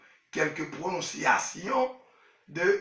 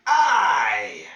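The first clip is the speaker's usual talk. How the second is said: I shouted out loud and lower; 4 semitones lower, 8 dB louder than usual. Real, not acted. Wife yells the letter I at the lesson. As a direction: belt the letter I out. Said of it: "on va dire I".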